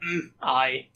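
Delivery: grunted Scottish assent; grunt, then a brief Scottish word. Acted. Game master proves Draco's grunt is Scottish, grunted Scottish accent. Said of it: "Is it a Scottish grunt?".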